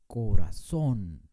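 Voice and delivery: corazón slow and loud, stressed on the last syllable, ko-ra-SON; correct stress. Shown as a demonstration. Teacher correcting students, corazón said slowly with the final syllable stressed. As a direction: draw out corazón and land hard on "ZÓN". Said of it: "No decimos corazón".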